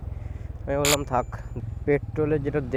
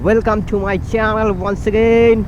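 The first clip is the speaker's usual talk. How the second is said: vlog intro; 8 semitones higher, 5 dB louder, much slower than usual. Acted. Channel intro greeting.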